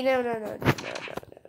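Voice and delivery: breathless whispered no, no, no; hushed frightened denial; whispered panic. Real, not acted. Scared at creature nearby.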